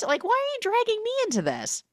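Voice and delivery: very high, fast indignant squeal; mock outraged disbelief; shocked squeal. Meg squeals at being dragged in, very high.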